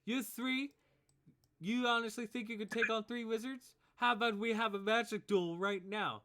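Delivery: high, loud bully's dare; scornful challenge. Acted. Bullies challenge kids to a duel.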